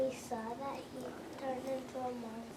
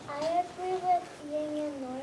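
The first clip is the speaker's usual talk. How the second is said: louder, projecting to the whole class; speaking up loud; 8 dB louder than usual. Real, not acted. Student asked by teacher to share, speaking up loud. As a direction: speak up so the circle hears you. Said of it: "Say it real loud".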